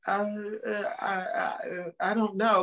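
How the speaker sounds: halting, unsure interviewee; mock flustered stammer; nervous stammer. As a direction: stammer like a nervous job candidate.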